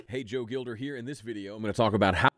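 the dry take, far too quiet; raw quiet recording. Real, not acted. Playing the raw take before mixing, very soft. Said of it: "raw recording".

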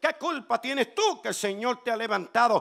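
ordinary talk, nothing marked

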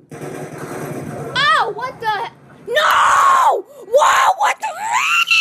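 real high-pitched outraged shout; outraged yell. Real, not acted. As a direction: scream in disbelief at the ambush. Ambushed in game, outraged.

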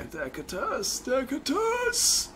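second Decatus stretched out high; mock sing-song. Teasing a friend over a place name, high drawn out. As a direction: drag out the name in a high silly voice.

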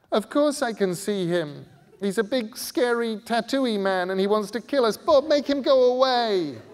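high, loud, panicky and cross; irritated scared girl. A narrator's reading. Scarlet demanding Bod send the man away, loud panicky. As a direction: snap at him, rising to panic. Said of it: "Scarlet sounded irritated now as well as puzzled and scared".

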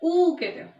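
a long full ऊ sound. Shown as a demonstration. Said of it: "अगर बड़ा ऊ आप प्रोनाउंस कर रहे हो".

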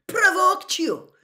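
very high, loud, drawn-out outraged echo; outraged, slow, soaring shout; incredulous shout. A narrator's reading. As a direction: shout it back in disbelief. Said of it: "shouted Professor McGonagall".

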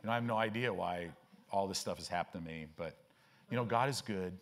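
low, quiet, listless mumbling testimony; mock half-hearted witness. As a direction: imitate someone witnessing with no freshness at all. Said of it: "and you're just like".

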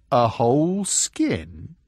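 slow, wondering giant's voice; a lumbering giant asking, puzzled; frost giant voice. Acted. Giant speaking slowly.

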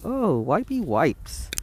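drawn-out slow silly coo; slow playful coo. Real, not acted. Painter cooing slowly while wiping his brush.

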